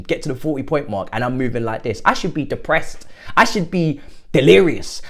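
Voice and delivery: ordinary talk, nothing marked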